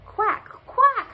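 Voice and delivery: high, loud quacking; duck squawk. A narrator's reading. Duck seeing the sun disappear, squawking high and loud. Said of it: "squawked Duck".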